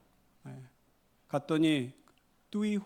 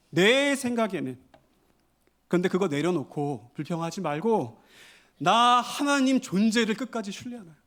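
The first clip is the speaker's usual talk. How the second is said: loud, high impassioned sermon; rising pulpit intensity, louder and higher; fervent preaching; 5 semitones higher, 6 dB louder than usual. Acted. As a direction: preach it out with full force. Preaching at full force.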